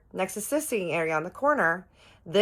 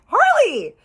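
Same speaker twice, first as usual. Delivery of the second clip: much higher, much louder scandalised yell of his name; shocked shout; 10 dB louder than usual. Retold or imitated. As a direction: shout his name to stop him cold. Scolding him for getting handsy.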